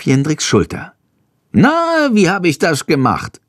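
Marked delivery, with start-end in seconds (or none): 1.5-3.4 much higher, proud little bird; fishing for praise; stolz. A narrator's reading. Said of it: "fragt er stolz". (Schandor fragt Jendrik nach Lob: hoch und stolz)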